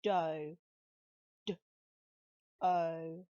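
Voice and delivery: put-on teacher spells out "dough" for young readers; spelling out a chunk. Shown as a demonstration. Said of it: "see if you can spell the second chunk".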